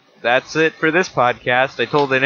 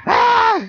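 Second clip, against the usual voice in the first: panicked yell; 5 dB louder than usual. Retold or imitated. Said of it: "they were freaking out".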